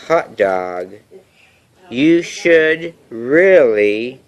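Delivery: usual speaking voice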